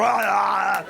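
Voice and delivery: prolonged high open-mouthed yowl; exaggerated endless-kiss noise; loud kissing wail. Couple still kissing after the song ends, loud yowl.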